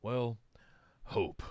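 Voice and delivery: low, soft, almost whispered; imitating Cody in a murmur; hushed mock Cody. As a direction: breathe the word hope like a deadpan mock. Mimicking Cody's answer about hope.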